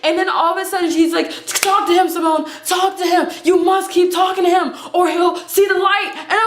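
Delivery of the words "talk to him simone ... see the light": urgent pleading. Retold or imitated. Acts manager's urgent plea.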